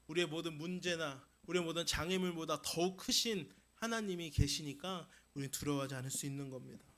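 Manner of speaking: usual speaking voice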